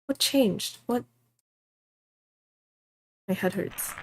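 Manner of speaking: usual speaking voice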